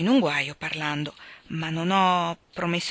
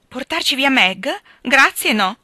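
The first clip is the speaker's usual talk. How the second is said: louder, higher, sarcastic refusal; indignant refusal; 8 semitones higher, 9 dB louder than usual. A narrator's reading. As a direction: throw the thanks back in his face. Joe rejects the idea.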